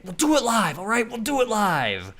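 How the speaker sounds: high, loud, furious yelled rant; mock scream. Imitating the TV host snapping on set, screaming. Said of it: "then he just started screaming".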